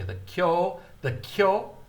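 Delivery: "kyo" said high and clipped as one syllable; short kyo syllable. Shown as a demonstration. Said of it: "kyo is short".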